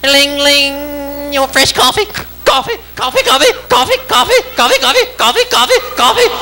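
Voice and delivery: very high repetitive squawk; manic waitress. Acted. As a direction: pester with a high, relentless waitress chant. Waitress phones him in bed.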